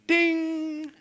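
high bright ding of realisation; idea ding. Acted. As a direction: sing out a lightbulb ding. Lightbulb moment sound effect.

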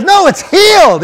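very high, yelled protest; insistent shout. Retold or imitated. Healed man insisting to his doubter, insistent shout. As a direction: yell it back, pitch soaring.

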